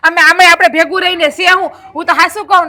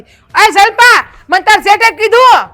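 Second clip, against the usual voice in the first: calling out; 5 semitones higher, 5 dB louder, slower than usual. Pretend. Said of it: "હું એને લાફો મારીને પછી જ કહે".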